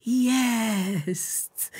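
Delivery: high, bright cry of discovery; Water spots the blank pages, excited shout; triumphant shout. Acted. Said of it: "krzyknęła Woda".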